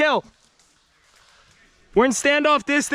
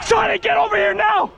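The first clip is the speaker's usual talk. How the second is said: high shout across distance; calling from far away; 8 semitones higher than usual. Real, not acted. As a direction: call him over urgently. Calling teammate from afar.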